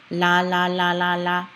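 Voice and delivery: "la" five times in an even run; L sound drill. Shown as a demonstration. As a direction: tap the roof of the mouth on each "la". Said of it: "tongue kinda hits the roof".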